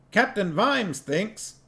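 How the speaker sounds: a high voice for the dwarf Cuddy, alarmed. A narrator's reading. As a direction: squeak it out like a startled dwarf.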